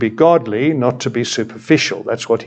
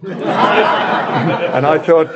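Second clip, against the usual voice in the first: laughing; 7 semitones higher, much slower than usual. Real, not acted. Laughs at the retold punchline.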